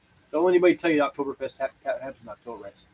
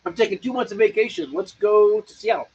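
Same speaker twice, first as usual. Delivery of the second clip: mock traveler; 6 semitones higher, 5 dB louder than usual. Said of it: "It's just like".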